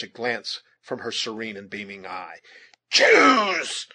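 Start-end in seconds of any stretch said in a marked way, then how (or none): 2.9-3.9 high, loud, drawn-out command; shouted demand. A narrator's reading. Huron chief pressing his unheeding captive, a desperate shouted demand. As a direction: shout the order at full force.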